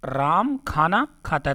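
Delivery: each word set apart, slow; slow example read. Shown as a demonstration. Teacher repeats the example for students, slow and deliberate. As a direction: spell the sentence out so students follow. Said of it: "जैसे मैंने बोला".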